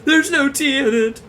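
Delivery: loud, high dramatic wail; mock horror shout. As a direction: yell it like a horror reveal.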